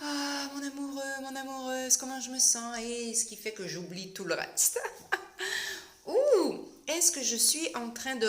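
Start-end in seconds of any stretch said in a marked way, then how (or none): 0.0-2.8 mock dreamy sighing over a sweetheart; swooning lover. Host mocking someone swooning in love, dreamily. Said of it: "pâmée en amour". 4.8-5.8 quick high giggle. Real, not acted. Host giggling at her own lovesick mockery. Laughs at own mock lover.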